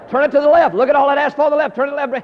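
high, very loud coaching dad; hollered directions. Retold or imitated. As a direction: yell instructions across a parking lot. Father hollering directions to child.

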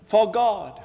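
"For God." with a loud, falling stress and a full stop after God; emphatic For God. Shown as a demonstration. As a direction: hit "For God." hard and stop. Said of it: "This is how he says it".

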